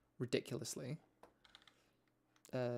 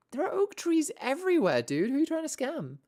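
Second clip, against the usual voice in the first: indignant; 13 semitones higher, 7 dB louder than usual. Real, not acted. Mocking the traveling merchant's prices.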